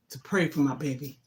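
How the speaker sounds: ordinary talk, nothing marked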